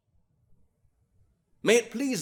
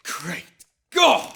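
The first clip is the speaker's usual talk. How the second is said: sudden high, loud cry of outrage; thundering appeal to heaven; outraged exclamation; 5 semitones higher, 8 dB louder than usual. Acted. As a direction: explode out of the silence. Outburst after hushed charge.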